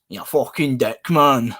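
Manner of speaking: imitating a slow Glaswegian accent; Glasgow accent. Streamer shows how Glasgow talks, slow mock Glaswegian. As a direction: drag out a mock Glasgow insult. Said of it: "you go to Glasgow and it's".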